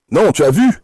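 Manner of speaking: ordinary talk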